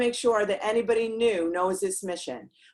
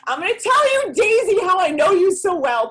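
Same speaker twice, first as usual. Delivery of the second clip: much higher and louder, bursting out; excited outburst; 14 semitones higher, 7 dB louder than usual. Real, not acted. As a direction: shout it to Daisy with sudden fire. Excited answer to a viewer.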